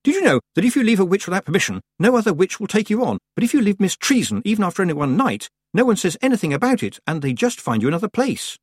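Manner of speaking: put-on high, quick gossipy girl; chatty girl voice. A narrator's reading. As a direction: rattle off the gossip. Gertruda voiced in story.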